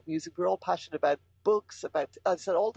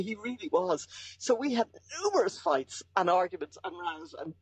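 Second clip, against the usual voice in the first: usual speaking voice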